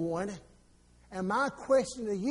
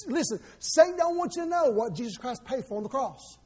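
usual speaking voice

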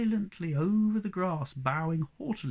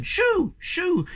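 high, loud frantic shoo; frantic shooing cries; 7 dB louder than usual. A narrator's reading. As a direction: shriek them away. Shooing the creatures away.

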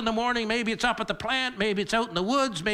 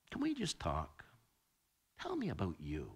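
put-on hushed, lower ask from the child; a grown child quietly asking the parent; soft child's plea; 13 semitones lower, 12 dB quieter than usual. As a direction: ask gently, almost under the breath.

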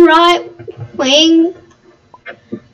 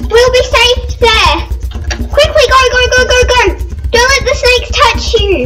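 urging everyone to flee the snakes; phobic panic; 7 semitones higher than usual. Acted. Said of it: "I am terrified of snakes".

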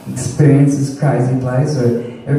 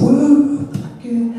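high mock-excited whoa, much higher; 12 semitones higher, much slower than usual. Telling the server about his swipe, high mock whoa. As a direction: yelp it up high, then drop off. Retells his own reply.

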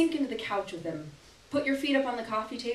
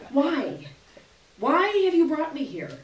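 higher, louder, pleading question; mock plea to feet; 5 semitones higher, 5 dB louder than usual. Woman at his door pleading with her feet, exasperated.

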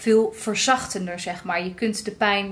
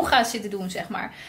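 ordinary talk, nothing marked

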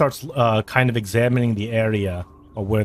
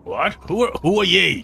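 suspicious guard; 8 semitones higher, 3 dB louder than usual. Acted.

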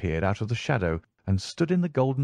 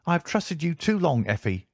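stern; 8 semitones higher than usual. A narrator's reading. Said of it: "he cried sternly".

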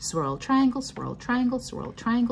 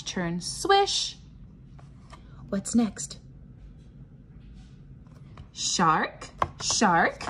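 lively sing-song read-aloud, chanted refrains higher; reading to children; 6 semitones higher, slower than usual. Acted. Teacher reading a pattern book to the class, bouncy sing-song chant. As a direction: chant the refrains like a nursery rhyme for little ones.

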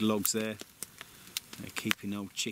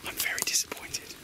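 mock disappointed whisper; breathy joking whisper. Jokey reply about forgotten breakfast.

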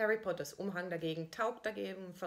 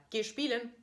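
higher, louder coaxing call; mother calling; 6 semitones higher, 6 dB louder than usual. Retold or imitated. Mother calling her son on the playground, higher and louder. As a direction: call the boy like a doting mother. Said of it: "sagt sie noch einmal".

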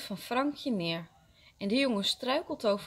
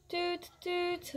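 toy train toot; 9 semitones higher, 3 dB quieter than usual. A narrator's reading. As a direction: play at being a train whistle. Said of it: "roept Frankje".

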